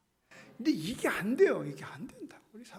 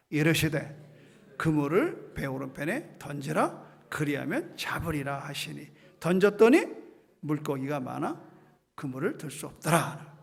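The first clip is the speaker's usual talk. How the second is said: scripture reading; 6 semitones lower, 4 dB louder than usual. Acted. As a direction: read it like Scripture. (엄숙한 성경 낭독)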